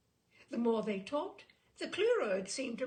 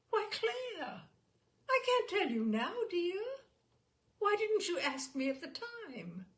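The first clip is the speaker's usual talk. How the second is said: innocent surprise; 9 semitones higher, 3 dB louder than usual. A narrator's reading. Mother innocently answering father.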